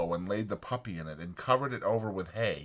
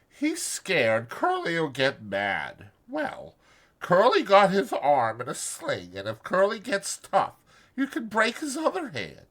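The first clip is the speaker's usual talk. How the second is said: Curly's wife, quietly; 8 semitones higher than usual. A narrator's reading. Curly's wife quietly needles Lenny in a high voice. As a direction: needle him gently. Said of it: "she said quietly".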